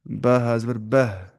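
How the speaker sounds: slow sounding out of बह हा ज़बर बह; letter sound demo. Shown as a demonstration. Teacher demonstrating letter articulation slowly. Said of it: "हम बोलेंगे".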